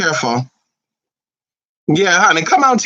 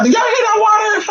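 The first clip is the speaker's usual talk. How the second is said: a real excited shout, much higher; excited call; 14 semitones higher, 5 dB louder than usual. Real, not acted. Telling viewers about the water outside, excited shout. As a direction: call out loud and delighted about the sound.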